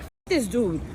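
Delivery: low, scornful inner voice; mock dismissive. Interviewer voicing her own thought about the candidate, low scorn. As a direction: drop it low and contemptuous. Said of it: "I'm like".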